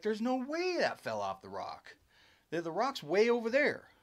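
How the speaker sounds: high-pitched mock incredulous protest; retold disbelief. Les answering about the fallen rock, imitated disbelief. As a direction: imitate a friend who can't believe it. Retells friend's disbelieving reply.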